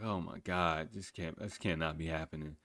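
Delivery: stunned murmur. Retold or imitated. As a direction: mutter it to yourself in shock. Shocked by coworker's suggestive talk.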